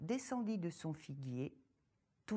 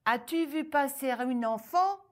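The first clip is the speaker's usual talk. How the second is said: ogress asking; 7 semitones higher, 9 dB louder than usual. Acted. Ogress questions the farmer.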